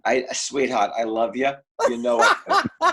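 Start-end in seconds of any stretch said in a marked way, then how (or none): 1.9-2.9 laughing. Real, not acted. Affectionate teasing with laughter.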